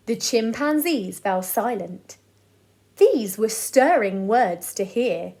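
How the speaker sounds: put-on lively rhyming read-aloud to children; bouncy sing-song storyteller; storybook reading. A narrator's reading. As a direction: read it like a bedtime picture book for the boys. Reads rhyming picture book aloud.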